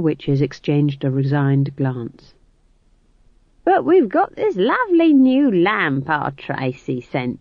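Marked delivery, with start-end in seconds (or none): 3.6-7.4 put-on slow, higher, innocent old woman; all feigned innocence. A narrator's reading. Nanny Ogg dodging Magrat's candles, innocently. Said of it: "said Nanny Ogg innocently".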